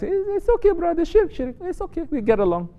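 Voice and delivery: high, sweet, mocking voice of a friendly zealot; mock nice Wahhabi. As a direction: lift the voice way up in sarcastic kindness. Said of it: "You never see any Wahhabi nice".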